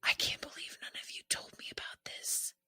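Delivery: teasing whisper of betrayal; whispered aside. Acted. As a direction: hiss it under your breath like a joking accusation. Mock outrage aside to co-host.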